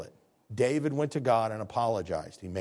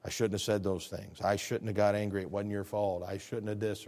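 humble apology; 6 semitones lower, 4 dB quieter than usual. Shown as a demonstration.

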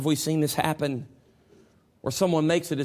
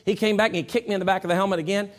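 ordinary delivery

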